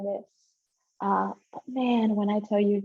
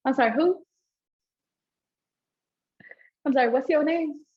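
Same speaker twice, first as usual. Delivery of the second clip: loud, raised "who?"; shocked disbelief; 5 semitones higher, 5 dB louder than usual. Retold or imitated. Hearing the girl's name on the phone, stunned. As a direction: ask again in stunned disbelief.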